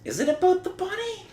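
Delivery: put-on, very high-pitched voice; squeaky mock question. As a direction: ask it in a tiny high squeak, imitating a character. Acting out Lucy's anxious question.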